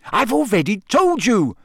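high, heated outburst; Hermione, very angry. A narrator's reading. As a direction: let exasperation burst out high. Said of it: "Hermione said very angrily".

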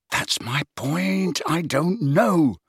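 slow, high-pitched frustration; stretched, exasperated protest; frustrated. A narrator's reading. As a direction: drag the words out in exasperation.

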